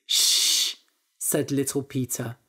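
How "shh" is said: long voiceless shush; hushing shh. A narrator's reading. Little Peter silences the priest in the basket, hushing. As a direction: shush someone firmly.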